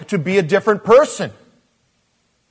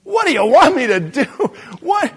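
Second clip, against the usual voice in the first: high, louder pressing question; Jesus pushing for an answer; incredulous question; 10 semitones higher, 5 dB louder than usual. Acted. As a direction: ask it again in disbelief.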